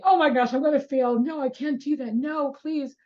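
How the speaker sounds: high, loud, panicked pleading; acted panic. Shown as a demonstration. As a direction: play a student terrified of failing the test. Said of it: "you hear me saying".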